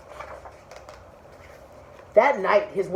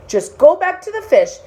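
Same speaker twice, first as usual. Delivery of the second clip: high, louder insistent order; insistent wife; 9 semitones higher, 4 dB louder than usual. Acted. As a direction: push him out the door.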